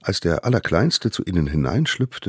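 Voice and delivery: plain reading voice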